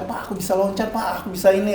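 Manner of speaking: high but soft excited report; retold delight. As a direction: relive happily telling dad, pitch lifted. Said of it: "langsung seneng banget kan gini".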